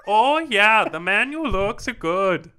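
a loud, slow bad Italian accent that sounds Dutch; botched Italian accent. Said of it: "he talked like a Dutch person".

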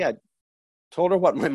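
normal talking voice

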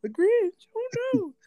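very high, hammed-up shriek; mock panicked Whos. Townsfolk panic at the Grinch, very high mock shriek. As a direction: squeal in cartoon horror. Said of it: "I was gonna say".

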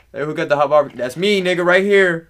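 loud, high holler across the table; calling out. Acted. Hungry diner claiming a plate from the waiter, calling out. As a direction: shout it out eagerly.

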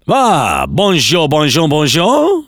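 high, drawn-out French policeman; French language police. Acted. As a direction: play an over-the-top French officer arriving. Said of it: "imagine the language police turning up".

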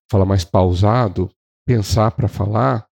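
slow, spaced-out phrases. Shown as a demonstration. Trainer showing salespeople how to match a slow speaker, measured. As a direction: take your time on each phrase. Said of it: "se o cara fala mais devagar".

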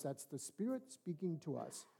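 normal talking voice

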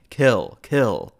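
assassins' loud chant as they strike; shouting. A narrator's reading. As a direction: bark the word twice at the victim. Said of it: "began shouting".

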